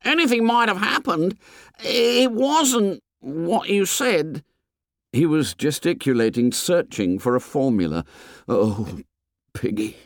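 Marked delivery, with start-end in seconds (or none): 0.0-4.4 put-on high, agitated boy; shrill, worked-up denial; excited protest. A narrator's reading. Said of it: "said Piggy excitedly". 8.5-10.0 subdued, pained address; low and stricken. A narrator's reading. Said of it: "low and stricken".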